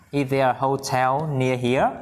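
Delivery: slow, stretched question rising on "here"; slow model reading. Shown as a demonstration. Teacher asking if a hotel is near, slow model. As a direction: draw out "Is there a hotel near here" for learners.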